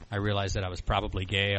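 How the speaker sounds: ordinary talk, nothing marked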